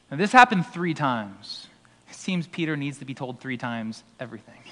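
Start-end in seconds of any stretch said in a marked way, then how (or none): 2.1-4.6 soft whispered joke; teasing whisper; whispered aside. Real, not acted. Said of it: "whispered aside".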